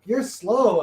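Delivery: high, slow teasing sing-song. Real, not acted. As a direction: tease him playfully, drawn out. Teasing friend for slowness.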